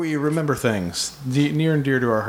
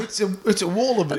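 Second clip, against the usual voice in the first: higher-pitched Aussie wildlife spotter; mock Australian accent; 5 semitones higher than usual. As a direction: pipe up as a pretend Australian. Riffing in mock Australian voices.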